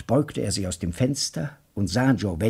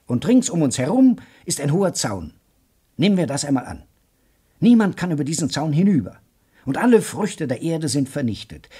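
a keen talker carrying two stunned men along; hammed-up enthusiast; excited lecturer; 5 semitones higher than usual. Joe Welling lays out his scenario.

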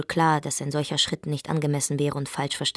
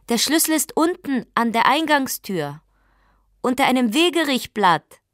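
higher and louder call, repeated; calling again; 8 semitones higher, 6 dB louder than usual. Acted. Wife calling the key's hiding place to her husband again. Repeats instruction through door.

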